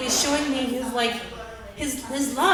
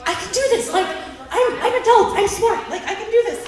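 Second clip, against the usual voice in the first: high, louder laughing insistence; laughing self-pep; 9 semitones higher, 4 dB louder than usual. Retold or imitated. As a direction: convince yourself you can do it, laughing. Pep talk while failing with bags.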